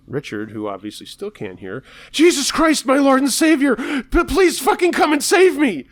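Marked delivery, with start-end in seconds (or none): 2.1-5.9 very high, loud shrieking plea; frantic screaming prayer. Acted. Bloodied man screaming at heaven to be saved, frantic shriek.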